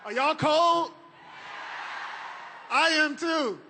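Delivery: loud rally greeting to the crowd; podium speech. Real, not acted. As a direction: call out to a big outdoor crowd. Speaker greets a cold rally crowd.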